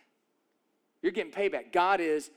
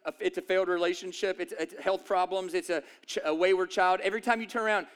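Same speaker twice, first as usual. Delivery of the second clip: normal talking voice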